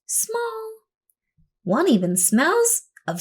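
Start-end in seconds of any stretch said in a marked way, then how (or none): 0.1-0.7 high, soft little word for small; tiny voice. A narrator's reading. Narrator shrinks her voice for small. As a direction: make the word sound small. Listing the shapes she tries.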